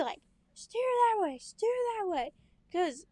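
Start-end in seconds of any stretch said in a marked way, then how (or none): 0.5-2.3 high, loud barked orders; yelling. Retold or imitated. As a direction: yell the orders like a frustrated dad. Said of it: "he'd yell at you".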